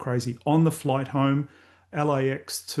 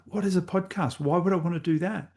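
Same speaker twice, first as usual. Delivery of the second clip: higher, mock-bewildered questioning; baffled retort; 5 semitones higher than usual. Sons keep telling him to start a podcast, higher mock bafflement. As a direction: play the puzzled dad who doesn't get it.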